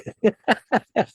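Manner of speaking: high, bright burst of real laughter; sudden high laugh at the punchline. Real, not acted. Guest laughs at own story.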